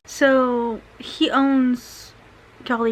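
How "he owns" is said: ordinary talk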